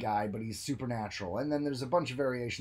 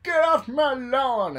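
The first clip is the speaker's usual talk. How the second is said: high, loud, slow cranky yell; hammed-up old-man yell; grumpy old man; 13 semitones higher, 11 dB louder, slower than usual. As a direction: shout like an old man shooing kids. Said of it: "some old man being like".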